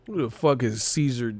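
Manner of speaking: usual speaking voice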